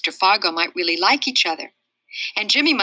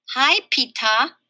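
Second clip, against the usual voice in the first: cheeky greeting; 6 semitones higher, 3 dB louder, slower than usual. Acted. Fudge teasing after ice prank.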